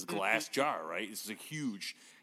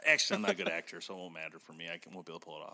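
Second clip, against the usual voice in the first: ordinary talk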